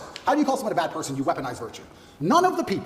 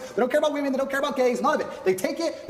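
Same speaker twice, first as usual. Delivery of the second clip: ordinary talk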